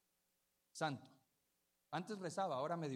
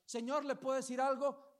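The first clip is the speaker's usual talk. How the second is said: higher, louder pleading woman; imitating a beggar woman; 9 semitones higher, 8 dB louder than usual. Pastor voicing the woman at the supermarket, pleading. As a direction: call out to a passerby, asking.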